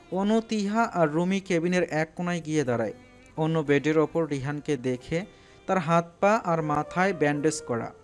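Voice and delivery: usual speaking voice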